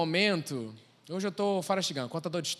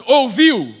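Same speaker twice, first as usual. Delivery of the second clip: loud, forceful "Desistir não é uma opção", rising high; falando firme; 6 semitones higher, 13 dB louder, slower than usual. Acted. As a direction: proclaim it like a rallying cry. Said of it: "vai falar assim". (anúncio forte e exaltado)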